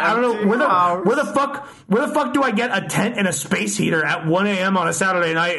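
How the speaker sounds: spikes high on where the fuck, then rants on; disbelieving panic at one A M; exasperated outburst. Retold or imitated. Exasperated at impossible advice.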